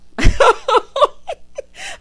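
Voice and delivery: high, loud burst of laughter. Real, not acted. Laughing at her joke about Southern divorces. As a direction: crack up.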